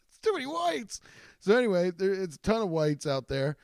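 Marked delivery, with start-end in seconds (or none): 0.1-1.0 much higher, comic whining voice; hammed-up high complaint; mock complaint. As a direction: pitch it way up like a silly protest. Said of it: "And I'm like".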